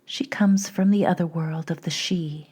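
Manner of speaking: measured meditation voice with long pauses; unhurried, even guided-journey narration. Acted. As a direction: gently lead listeners inward, leaving space between phrases.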